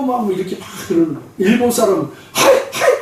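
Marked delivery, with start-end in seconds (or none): 2.3-3.0 short mock yelps of alarm; startled Japanese man. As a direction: jump with fright, playing a Japanese man. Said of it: "막 뭐 놀래 자빠지는 거예요". (깜짝 놀란 일본인 흉내)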